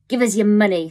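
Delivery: loud, menacing robber demand; threatening demand. Acted. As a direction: bark it at him like a street robber. Robber woman demands money.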